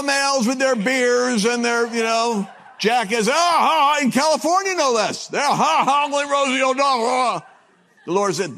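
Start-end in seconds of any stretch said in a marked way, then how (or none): 3.3-6.0 loud, guffawing imitation of the bar crowd; mock guffaw, a touch louder; mock bar laughter. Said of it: "all the guys in the bar started laughing". 6.1-7.5 chuckling as he echoes the punchline; lightly laughing echo of the quip; laughing repeat. Retold or imitated. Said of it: "all the guys in the bar started laughing".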